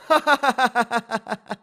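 high, loud peal of laughter; lady's laughter. A narrator's reading. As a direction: burst out laughing helplessly. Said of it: "Дама металась ... от смеха".

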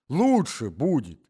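high, slow echo of his own words; old husband repeating himself to wife, high and slow; old man repeating himself. A narrator's reading. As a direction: say it again like a muddled old man.

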